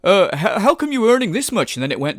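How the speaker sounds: loud, caricatured question and answer; mock dialogue. Commentator parodies lawyer questioning his client, mocking. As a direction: act out both sides with exaggerated huhs. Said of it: "As if this fucking lawyer went".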